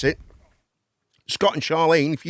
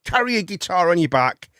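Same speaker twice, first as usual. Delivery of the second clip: ordinary talk, nothing marked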